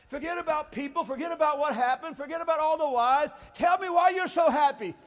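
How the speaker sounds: high, shouted preacher climax; full-force preaching. Real, not acted. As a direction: fire up the congregation with shouted declarations.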